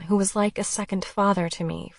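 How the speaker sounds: plain reading voice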